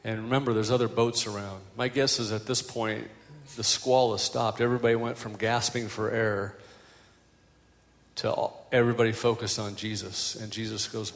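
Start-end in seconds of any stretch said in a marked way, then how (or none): none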